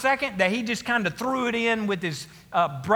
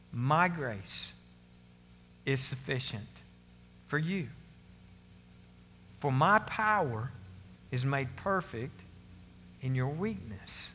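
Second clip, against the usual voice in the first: God's answer; 7 semitones lower, 7 dB quieter than usual. Retold or imitated. God answering Paul's plea, low soft solemn. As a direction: speak it quiet and grave, letting pauses hang. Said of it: "But he said to me".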